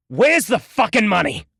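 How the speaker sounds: loud high furious demand; angry. A narrator's reading. Duran losing his temper with Jason, furious loud demand. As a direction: explode with rage. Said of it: "as anger gripped him".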